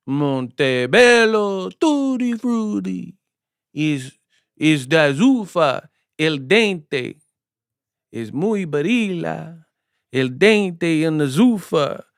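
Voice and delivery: slow, drawn-out mock Italian; fake Italian accent. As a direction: fake foreign language, savoured slowly. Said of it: "fake Italian sounding".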